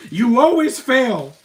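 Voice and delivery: always drawn out in a loud, high, slow jeer; mocking shout. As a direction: yell it down at the loser. Taunting a movie character on screen.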